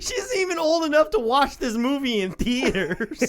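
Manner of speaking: high, laughing objection; mock incredulous protest. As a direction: protest in hammed-up disbelief. Acted shocked viewer reaction.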